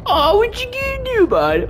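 very high, fast put-on squeak; squeaky mocking challenge to the enemy; silly mock voice. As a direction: jeer at him in a cartoonish high voice.

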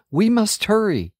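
urgent. A narrator's reading. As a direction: hurry him along. Running to the fire.

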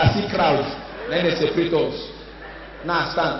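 ordinary talk

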